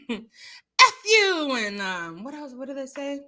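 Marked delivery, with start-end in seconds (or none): 0.1-1.5 sharp, raised mock curse; mock cussing. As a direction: spit it out like a fight. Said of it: "They're going like".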